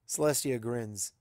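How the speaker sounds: ordinary delivery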